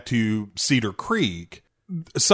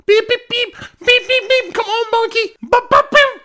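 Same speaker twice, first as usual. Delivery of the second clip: very high squeaky beeping call; shrill singsong beep-boop calls; hideous monkey-calling sounds; 5 dB louder than usual. Retold or imitated. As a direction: squeal it like a kid luring a monkey. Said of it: "starts making hideous sounds like".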